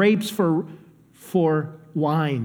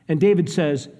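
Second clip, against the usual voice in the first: ordinary talk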